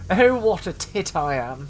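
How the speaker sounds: hammed-up posh English. Gamer repeating his insult in an exaggerated posh English voice. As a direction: ham up the posh English accent on purpose. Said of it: "in such a posh voice".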